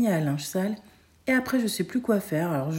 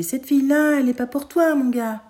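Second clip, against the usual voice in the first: high, loud mock advice to the screen; talking to the TV; 7 semitones higher, 5 dB louder than usual. Mother advising a TV character with mock weight. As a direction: lecture the TV hero. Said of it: "Elle dit à la télé".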